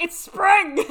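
high, loud exclamation in imitation; hammed-up yell; mock cheery shout. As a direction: holler it like a character quote. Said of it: "Murray's like".

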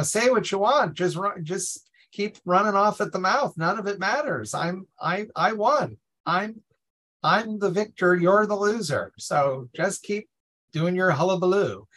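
high, gloating singsong brag; gloating taunt. Retold or imitated. Darby gloating over the leprechaun king, high mocking taunt. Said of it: "he's just saying".

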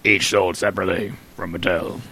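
deadpan echo of the ad's fine print; toy commercial announcer. Retold or imitated.

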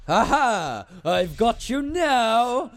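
high, slow triumphant cackle; gloating villain. Pretend. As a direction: crow like a cartoon villain. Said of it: "it's like".

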